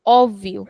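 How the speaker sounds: "Óbvio" with a long open first o; extended o. Shown as a demonstration. Teacher repeating the word for the class, long open o. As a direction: hold the opening o of "Óbvio". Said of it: "we are extending the sound of o".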